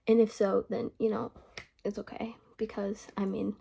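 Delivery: ordinary talk, nothing marked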